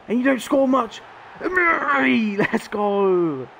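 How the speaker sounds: real excited urging of the striker; hyped urging. Real, not acted. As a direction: pump up the substitute. Urging sub to score.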